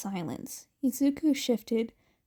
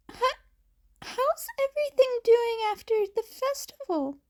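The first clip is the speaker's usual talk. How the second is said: put-on high, fast, anxious boy's voice; timid teen forcing small talk; nervous high Izuku; 12 semitones higher than usual. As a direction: blurt it out nervously, voice cracking. Izuku nervously breaking the silence.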